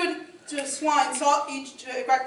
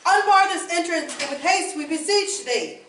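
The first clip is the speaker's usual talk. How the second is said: loud, higher urgent call; 5 semitones higher, 5 dB louder than usual. Acted. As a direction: call through the door in a hurry. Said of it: "calling out".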